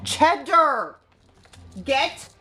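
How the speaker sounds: sharp loud call of the dog's name. Real, not acted. Owner scolding Cheddar to get down, loud call.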